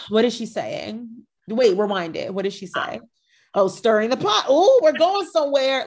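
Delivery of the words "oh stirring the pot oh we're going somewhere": rising to high and loud relish; light up as the drama kicks off; gleeful excitement. Retold or imitated. Viewer spotting pot-stirring on the show, gleeful. Acting out thrilled viewer reaction.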